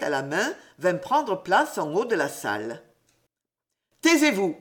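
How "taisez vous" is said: master silences the class, stern and raised. A narrator's reading. A stern raised call for silence. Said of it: "d'une voix sévère".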